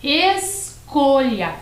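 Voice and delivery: "escolha" slow and high, ES, then COLHA with palatal LH; LH sound. Shown as a demonstration. Teacher shows the word made by adding H, LH sound. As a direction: break "escolha" after ES, LH like Italian gli. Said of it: "eu tenho agora a palavra".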